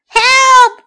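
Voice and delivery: high, loud yelp for help; scared yelp. Acted. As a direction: yelp in fright. Wolf flees in fright.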